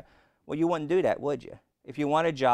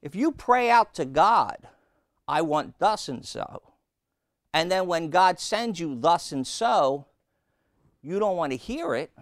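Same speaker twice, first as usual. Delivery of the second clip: higher, louder preaching build to a plea; 5 semitones higher, 4 dB louder than usual. Acted. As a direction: drive the point home to the congregation. Preaching climax.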